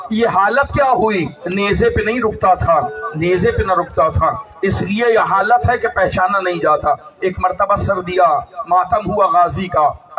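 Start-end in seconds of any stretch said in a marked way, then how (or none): none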